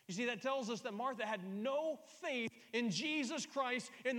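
high, loud, emphatic sermon climax; full-force preaching. Real, not acted. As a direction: preach it with rising fervor.

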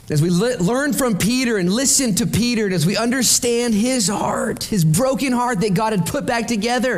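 high-pitched, driving sermon delivery; impassioned preaching. Acted. Preacher recounting Peter quitting after the resurrection, high and impassioned. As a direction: proclaim it with rising urgency. Preaches appeal at raised intensity.

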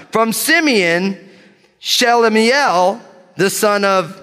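bold reading. Real, not acted. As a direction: proclaim each name as if sure of it. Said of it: "gonna read these names with boldness".

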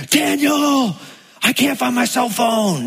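high shout of his name across the house; wife calling out. Retold or imitated. Wife calls out from another room.

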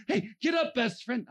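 high, loud, over-eager encouragement; hammed-up chummy shout; gushing fake friendliness. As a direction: call out like a sudden best buddy. Acts crowd suddenly friendly to Bart.

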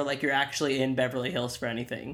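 ordinary talk, nothing marked

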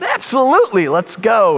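high, excited acted reply; eager child's yes. Son invited to a ninja movie, eagerly agreeing. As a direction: jump at the invitation like a keen kid.

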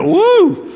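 loud, very high whoop; a high hoot at a sudden chill; chilled "woo". Retold or imitated. Husband reacting to his wife's text, loud chilled whoop. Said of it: "I felt a chill".

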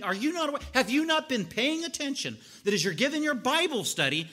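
loud, high, rushed rant; frantic complaint. Retold or imitated. Martha complaining to Jesus, loud frantic rant. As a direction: rant in exasperation, rising to a yell. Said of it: "reenact what she was like".

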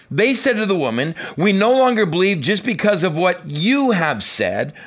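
high, loud scripture reading; preacher reading scripture. Real, not acted. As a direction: proclaim the passage to the congregation. Reads scripture aloud to congregation.

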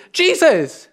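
high, indignant plea; hammed-up complaint, pitched high all through; agitated Martha. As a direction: protest up high, stretching the grievance. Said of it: "Martha gets so agitated".

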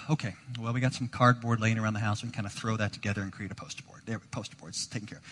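deflated patience, trailing off; weary resigned dad. Retold or imitated. Dad to son, sighing and resigned about the poster board.

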